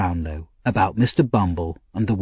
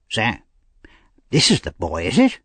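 Mister Grimwig. A narrator's reading.

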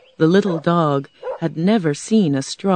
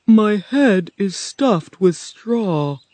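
slow, higher, loud lament; sad scarecrow; 5 semitones higher, 5 dB louder, much slower than usual. A narrator's reading. Scarecrow on his straw head, sadly. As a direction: draw out a sorrowful complaint. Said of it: "the scarecrow said sadly".

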